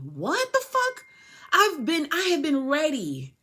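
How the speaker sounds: high, slow, drawn-out exclamation; incredulous squeal of outrage; outraged disbelief. Real, not acted. Outraged at being told not ready.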